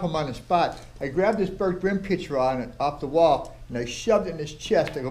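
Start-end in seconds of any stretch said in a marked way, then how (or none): none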